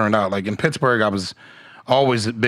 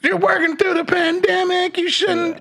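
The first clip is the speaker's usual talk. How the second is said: much higher, whiny finger-wagging voice; mocking scold. Comic mimicking online critics, high whiny scolding. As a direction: mimic a preachy commenter. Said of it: "people started hitting me".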